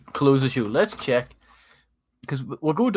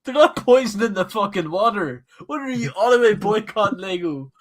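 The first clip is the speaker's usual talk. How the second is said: laughing; 7 semitones higher, 3 dB louder than usual. Real, not acted. Laughing rant about Lego boycotters.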